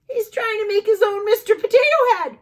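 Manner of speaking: high, loud, fast excited. Acted. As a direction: rush it out delighted. Excited gasp at food play.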